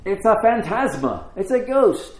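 high-pitched mock fright; scared to death. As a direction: cry out like you've seen a ghost. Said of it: "they are scared to death".

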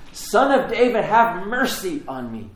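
acted calling out for mercy; shouting out. Blind Bartimaeus begging by the road, shouting out. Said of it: "he's shouting out".

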